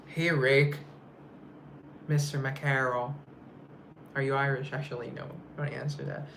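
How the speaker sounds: ordinary talk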